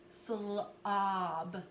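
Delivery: slob drawn out, lingering on sl; stretched out. Shown as a demonstration. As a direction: stretch slob slowly, sl then ob. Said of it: "So let's stretch it out".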